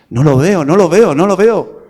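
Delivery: getting irritated searching through a spyglass; frustrated. Retold or imitated. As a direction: grumble as if the lion won't show.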